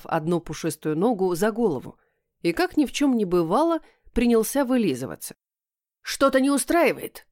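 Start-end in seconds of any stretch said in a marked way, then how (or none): 6.0-7.3 hostile bark pitched much higher; рявкает кот. Acted. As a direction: snap it at her like an irritated cat. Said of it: "рявкнул кот слишком уж враждебно". (враждебный рявк кота, голос выше)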